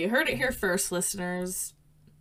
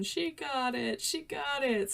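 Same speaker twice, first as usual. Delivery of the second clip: high, softer mock cheer; 6 semitones higher, 6 dB quieter than usual. [name] cheering Emily on, high and soft. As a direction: chant it like a giddy character. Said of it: "[name] and Nation".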